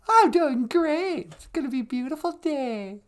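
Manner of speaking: very high-pitched little character; sidekick voice. Pretend. Chirpy sidekick answering "How you doing, sweetie?", high character voice.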